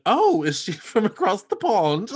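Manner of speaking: laughing, startled question; surprised. Retold or imitated. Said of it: "when that accent came out, I went".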